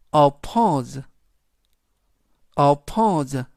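oppose with the stress on po and a buzzing z at the end; pronunciation model. Shown as a demonstration. Teacher models oppose for learners, stress on po, final z. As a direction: say oppose as o-POZE, ending in z not s. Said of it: "포의 강세가 있습니다".

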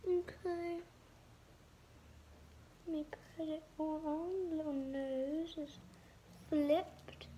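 wet sheet mask on face; speaking through mask mouth hole; talking with face mask on. Real, not acted. Seen 1: Sheet mask on face throughout; mouth hole leaves lips mostly free.

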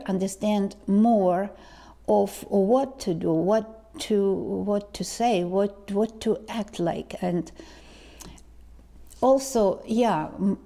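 ordinary talk, nothing marked

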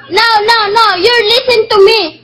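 ordinary talk

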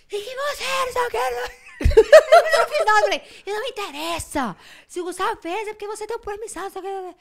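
high-pitched scolding with laughter breaking in; mock furious rant. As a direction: re-enact telling someone off, high and worked up. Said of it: "eu fiquei com ódio". (bronca aguda, imitando raiva)

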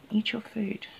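whispered coax to the pet; soft whisper urging the bird to eat. Real, not acted. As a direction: hush it right down to a whisper.

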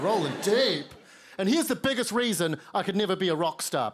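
normal talking voice

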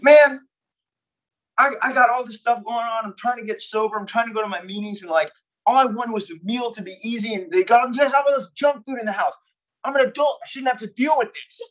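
high, loud, upset rant at the house manager; pushed-to-the-edge complaint, voice pitched well up; frustrated angry outburst. Retold or imitated. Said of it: "started getting angry".

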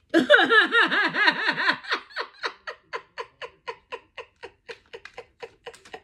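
long, high burst of real laughter; helpless loud cackle. Real, not acted. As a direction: crack up hard and high.

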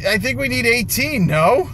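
ordinary talk, nothing marked

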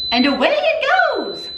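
high, excited send-off; excited send-off as the cooker starts; excited cheer. Real, not acted. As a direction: cheer the pot on its way.

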